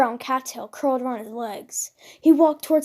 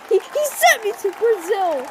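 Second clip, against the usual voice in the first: choking up; 8 semitones higher than usual. A narrator's reading. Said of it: "choking up".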